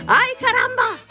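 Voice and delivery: put-on very high Spanish-accented outcry; startled exclamation. A narrator's reading. Kitten challenging strange Chihuahuas, startled exclamation. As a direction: cry out high in mock alarm.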